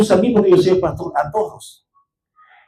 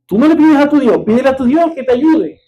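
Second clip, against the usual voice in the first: high-pitched taunting imitation; mocking; 6 semitones higher than usual. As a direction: tease him about his God. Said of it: "no te burles de mí".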